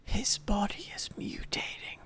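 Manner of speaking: whispered, spooky narrator tone; mock eerie whisper. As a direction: hush it like a campfire horror line. Mock ominous aside to viewers.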